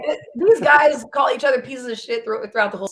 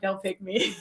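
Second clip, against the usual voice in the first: hushed plea; 4 semitones lower, 12 dB quieter than usual. Retold or imitated. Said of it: "I was like".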